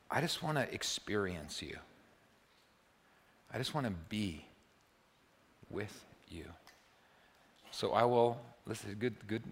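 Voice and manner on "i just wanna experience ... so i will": soft, lowered voice with long pauses; hushed, reflective praying; contemplative prayer. Acted. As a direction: speak gently to God, unhurried. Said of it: "a contemplative prayer".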